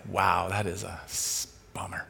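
low, soft letdown; deflated reaction. Retold or imitated. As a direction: let it sink in quietly. Retelling own deflated sympathetic reaction.

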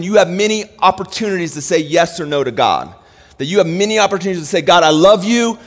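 high, loud, driving sermon voice; impassioned preaching. Real, not acted. Pastor tells church what covenant is, impassioned.

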